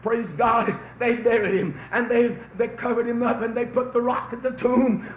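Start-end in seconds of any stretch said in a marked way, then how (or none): none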